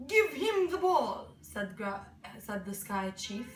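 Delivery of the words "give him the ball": loud, raised order; high, loud character voice; chief's command. A narrator's reading. Sky Chief gives an order.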